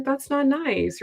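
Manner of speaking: mock-hurt lover protesting a cold partner's games. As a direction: plead it like a wounded partner. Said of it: "So you're gonna be like".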